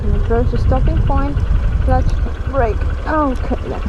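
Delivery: muffled voice in a helmet; talking inside a helmet on the bike; inside a motorcycle helmet. Real, not acted. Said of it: "You can hear me in, in the helmet".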